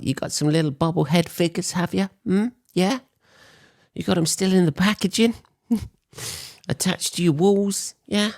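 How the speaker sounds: high, put-on voice teasing a collector; mocking geek voice. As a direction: tease the fan in a high, fast put-on voice.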